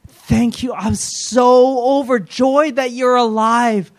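high, gushing cry of worship; acted rapture, stretched and effusive; overjoyed outpouring of praise. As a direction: pour out amazed thanks, pitched right up. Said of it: "the outpouring of praise".

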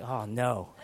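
low, deflated dismay with a laugh; mock dread. Parent as the sleeping baby wakes up, mock dread. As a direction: sink into comic dismay.

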